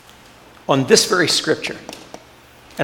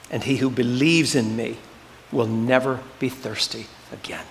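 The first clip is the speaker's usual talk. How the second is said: lower, hushed Bible reading; scripture reading; 4 semitones lower than usual. Acted. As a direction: read the words reverently, fading. Reads scripture passage.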